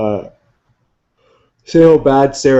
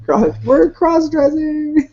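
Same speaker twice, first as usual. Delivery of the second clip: silly high voice; much slower than usual. Pretend. Drawn-out joke voice.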